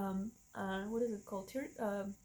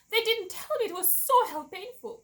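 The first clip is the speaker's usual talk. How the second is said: louder and much higher, outraged; indignant outburst; 10 semitones higher, 7 dB louder than usual. Real, not acted. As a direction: burst out that nobody warned her. Complaining about the hidden pain.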